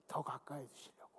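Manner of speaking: quietly, almost to himself; hushed prayerful murmur; soft murmur. Acted. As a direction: let the words sink low and soft. Soft repeated prayer phrase.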